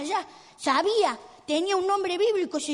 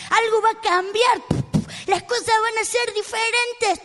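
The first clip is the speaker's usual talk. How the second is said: loud, higher excited declarations; rising determination; 5 semitones higher, 5 dB louder than usual. Acted. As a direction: psych yourself up with growing hope. Blind man works himself up hopefully. (entusiasmo decidido y en voz alta)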